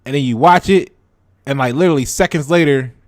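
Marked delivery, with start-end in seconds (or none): none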